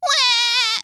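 very high, loud fake crying wail; imitated child wail. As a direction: mimic a toddler bawling. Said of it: "if she starts going".

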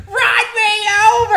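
high, loud mock scream while laughing; mock screaming kid. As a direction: shriek it like the kids in the road. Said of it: "they were just screaming at me".

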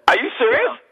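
high-pitched incredulous question; shocked disbelief. Real, not acted. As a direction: gasp it out in disbelief. Shocked reaction to the EVP words.

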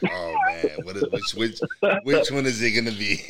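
talking through laughter; retold reaction, breaking into laughs; amused. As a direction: laugh your way through the words. Retelling a video that cracked him up.

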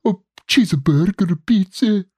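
high, halting cartoon Italian; squeaky-high exaggerated Italian accent; hammed-up Italian accent. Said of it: "Do you like my Italian accent?".

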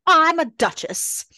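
high, loud indignant shout; indignant outburst. Retold or imitated. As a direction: snap it like an offended duchess. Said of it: "she's like".

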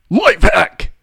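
high, loud announcer-style punch; vintage cartoon voice. Shown as a demonstration. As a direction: sell it like an old cartoon. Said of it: "The way you say it".

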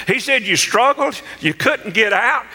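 high-pitched, driving pulpit delivery; fervent preaching. Retold or imitated. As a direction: preach it up to a climax, stretching key phrases. Preaching builds over the retold story.

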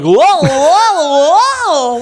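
high, loud clown whoa; silly clown. Retold or imitated. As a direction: do a goofy clown voice. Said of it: "a clown voice".

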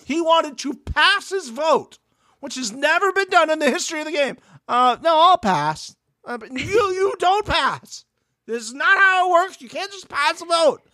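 high-pitched, exasperated protest; indignant rant. Real, not acted. Ranting about the husband wanting to pass, high and indignant.

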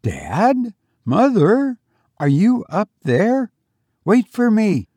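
put-on high, questioning boy's call; youthful calling voice. A narrator's reading. Son calling up to parents, youthful. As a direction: call up lightly with rising questions. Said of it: "the voice below called again".

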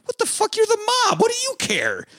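high, disbelieving outburst; mock the boss in a squeaky high voice; incredulous squeak. Real, not acted. Mock outrage at the mob boss.

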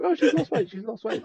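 hammed-up high-pitched Mickey; flustered Mickey. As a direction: blurt it out like a cornered boyfriend. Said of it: "He's to just go".

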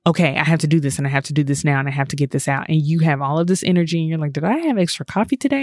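fast, words tumbling out over each other; hyper rushed talk. Retold or imitated. Describing manifestor energy bursts, fast rushed talk. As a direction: rattle it off like you've had too much coffee. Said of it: "you're talking like this".

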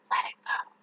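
soft unvoiced whisper. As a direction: breathe it out as a whisper. Whispered release.

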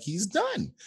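a high, thin "he's done"; high mock despair. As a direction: give up on him in a high voice. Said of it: "you'd be like".

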